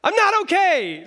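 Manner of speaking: high, loud cry of protest; hammed-up meltdown; frustrated shout. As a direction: yell it back at the comforter. Acted reply to comforter.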